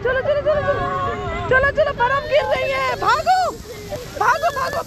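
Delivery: high, urgent cries to run; panicked urging. Real, not acted. Mother urging family to run from hail, high panic. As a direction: hurry everyone out of the hail.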